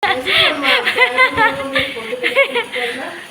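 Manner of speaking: a high burst of laughter, falling away; big laugh. Real, not acted. Laughing at her own joke. (ज़ोर की हँसी)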